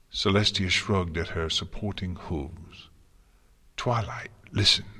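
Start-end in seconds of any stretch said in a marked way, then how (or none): 3.8-4.9 weak, rasped plea. A narrator's reading. Failing Celestia urging Twilight to listen, rasped. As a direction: rasp it out with effort. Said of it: "she rasped".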